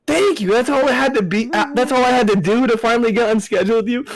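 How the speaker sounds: high, gleeful retold comeback; triumphant thanks. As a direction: crow it in mock gratitude.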